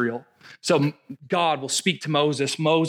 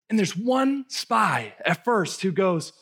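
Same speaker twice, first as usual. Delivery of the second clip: normal talking voice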